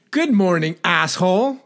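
high-pitched greeting, rising on "asshole"; contrasting-intent demo. Shown as a demonstration. Host showing the other intent of the same words, pointed high greeting. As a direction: pitch the whole greeting up high with a lift at the end. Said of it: "Or I can say".

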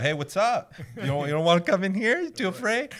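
loud, high, cocky taunt at the door; mocking taunt. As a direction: jeer at the thing, daring it to come in. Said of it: "open my front door and go".

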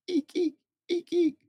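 squeaky eeks, very high; very high squeak. Acted. Dad reading to a child, very high squeaks. The noises repeated.

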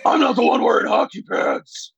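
put-on Dark Knight voice; mock Batman line reading. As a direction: send up the movie superhero's voice. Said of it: "trying to do a Batman voice".

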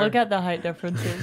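words breaking into laughter; giggling through it; laughing while talking. Real, not acted. Laughing at the donut strings.

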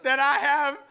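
put-on high, loud, slow childlike voice; mock the kid's earnest report; dad imitating daughter's earnest claim; earnest little girl. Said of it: "She said".

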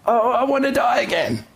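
high, hammed-up melodramatic moan; whiny Jonah. Preacher mocking Jonah wanting to die, high and melodramatic. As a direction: overplay the despair like a cartoon. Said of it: "comic book feel of the story".

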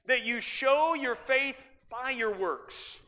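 ordinary talk

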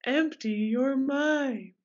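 empty your mind, slowly; slow calming voice. Pretend. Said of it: "Don't think about it too much".

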